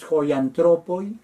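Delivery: "joiantropoi" said very slowly; very slow careful "joiantropoi"; slow Greek demo. Shown as a demonstration. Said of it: "los hombres".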